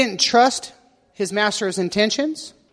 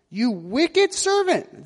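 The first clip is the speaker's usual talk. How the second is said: slow, higher-pitched mock roar; roaring rebuke; 6 semitones higher, much slower than usual. A narrator's reading. King rebuking the fearful servant, a mild mock roar. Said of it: "the king roared".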